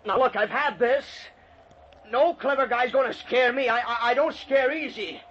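high, loud, blustering challenge; defiant shout. Acted. Man refusing to be scared by the ghost, defiant. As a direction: shout up at whoever is hiding, daring them.

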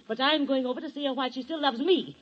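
ordinary delivery

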